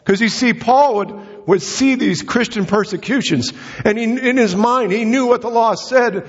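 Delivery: fervent preaching. Acted. Preacher stirring the congregation about Saul, fervent and high. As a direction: preach it with rising fire.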